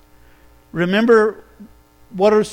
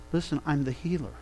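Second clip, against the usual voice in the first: quiet declaration; 6 semitones lower, 13 dB quieter, faster than usual. Acted. Jesus quietly declaring himself.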